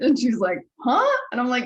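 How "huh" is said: much higher, louder baffled huh; a confused squeak of a question; imitating a friend's startled huh; puzzled huh.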